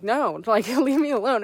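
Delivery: firm, half-laughing no; forceful refusal. Retold or imitated. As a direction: tell him to leave, loud and sharp. Pushing the man away.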